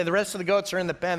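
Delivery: ordinary talk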